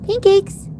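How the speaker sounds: calling pet. Real, not acted.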